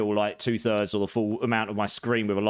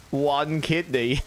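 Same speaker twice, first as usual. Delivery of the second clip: slow deadpan; 2 semitones higher, much slower than usual. Pretend. Reads the troll offer slowly.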